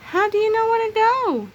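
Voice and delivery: high, loud little chick voices; anxious chicks. Acted. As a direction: squeak it out worried. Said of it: "the chicks asked Bibi anxiously".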